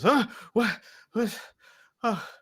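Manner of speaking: confused, startled little bursts; startled awake. Acted. Levine jumping awake after a smack, startled. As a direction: jolt out of sleep, bewildered. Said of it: "jumps awake".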